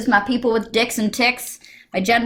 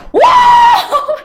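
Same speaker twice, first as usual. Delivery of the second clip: very high, loud "whoa"; shouted whoop; 10 dB louder than usual. Retold or imitated. Acting out her hand jerking while filling the form, sudden high shout. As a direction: burst out with a sudden piercing whoop. Imitates hand's sudden tic outburst.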